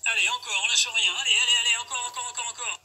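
loud, high hype shouting; gym bro. Acted. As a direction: push them hard through the final reps. Coach cheering on the last reps.

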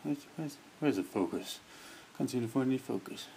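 ordinary talk

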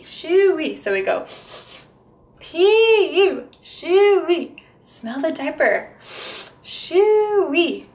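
high, loud playful pee-you; mock disgust. Therapist pretending a stinky diaper to amuse kids. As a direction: squeal at the stinky diaper in fun. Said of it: "pretend like we're smelling the baby's diaper".

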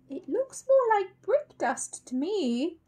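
high, slow knowing voice; lightly voiced suspicious mother; lady voice. Mrs Brown suspicious.

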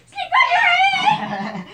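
high, slow shout through laughter; laughing shout. Real, not acted. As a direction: shriek it out laughing.

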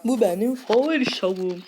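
high, loud mocking parrot; mocking imitation. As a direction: echo her words in a silly voice. Parrots the teacher's line.

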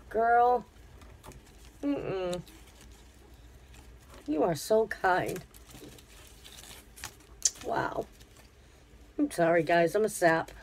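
really choking up, halting; touched and trying to hold it together; getting emotional. Real, not acted. Vlogger moved by a friend's gift, getting emotional. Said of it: "I'm gonna get emotional".

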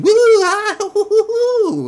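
very high, very loud mock whoops; piercing, hammed-up party cries; flamboyant whooping. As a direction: whoop like an over-the-top parade reveller. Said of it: "all their fucking flamboyant shit".

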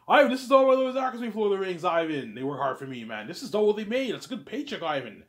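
high, loud insistence; Peter defending the deal. Acted. Peter arguing with Ivan over pay, high and loud. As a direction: hammer home that the actors are paid enough.

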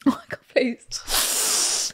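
slow, voiceless plea, worn out by laughing; trying to compose oneself. Real, not acted. Host begging for composure mid-letter, spent from laughing. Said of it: "I need to compose myself".

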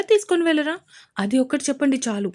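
insistent, rising mock-serious demand; demanding plea. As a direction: press him for a yes or no. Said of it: "సీరియస్గా చూస్తూ అడిగింది".

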